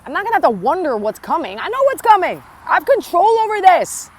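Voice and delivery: put-on high, loud, fast inner voice of someone stuck in old patterns; loud, rushed voice of the fearful mind talking itself into staying; inner voice ranting. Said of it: "in my head, I was like".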